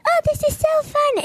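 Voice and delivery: put-on kid voice. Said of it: "we were like this when we were little".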